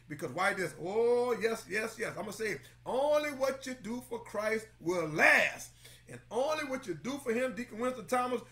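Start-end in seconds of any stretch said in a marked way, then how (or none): 2.8-5.7 drawn out, louder, landing on last; slow it down and drive it home; slow emphatic preaching; slow emphatic declaration. Acted. Preacher declaring key line.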